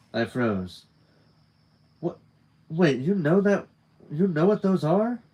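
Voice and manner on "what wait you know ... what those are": high, surprised questioning; incredulous, rising double question; startled disbelief. Acted. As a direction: ask it taken aback, pitch jumping up. Startled question after freezing in story.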